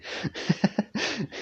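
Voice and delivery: a high little burst of giggling; high giggle. Real, not acted. Streamer giggles at chat teasing her age, high giggle. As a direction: giggle at the reminder. Laughing at chat's reminder.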